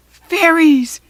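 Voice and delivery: loud, high startled yell; goblin yell. A narrator's reading. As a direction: yell it out in alarm. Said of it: "he yelled".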